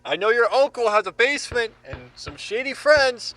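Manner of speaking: loud, high, laughing call-out; excited taunting. Acted. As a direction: bust the prankster cheerfully. Thinks friend is pranking him.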